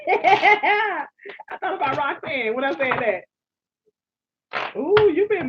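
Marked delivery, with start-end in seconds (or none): 0.0-3.4 slow, laughing through the words; laughing slowly at her own slip. Real, not acted. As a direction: chuckle while explaining the joke.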